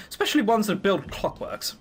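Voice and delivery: ordinary talk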